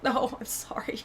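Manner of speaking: whispered, shrinking mock apology; sheepish apology. Apologizing for seeming to mimic family, sheepish whisper. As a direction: whisper it as if embarrassed. Retold embarrassed apology for accent.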